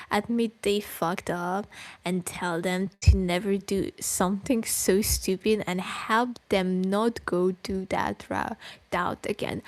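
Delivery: normal talking voice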